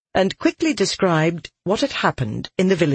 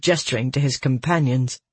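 ordinary delivery

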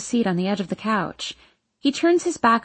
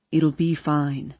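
low, slow reassurance; reassuring Hardin; 6 semitones lower, slower than usual. A narrator's reading. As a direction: soothe in a lowered, unhurried voice. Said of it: "Hardin's reassuring words".

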